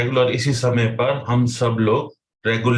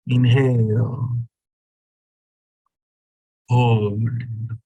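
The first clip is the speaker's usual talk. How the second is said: slow, measured meditation guide with long pauses; guided meditation; slower than usual. Real, not acted. Teacher guiding listeners to control their breath, calm meditation voice. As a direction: lead them calmly through each breath, drawing out the cues.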